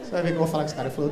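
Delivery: ordinary talk, nothing marked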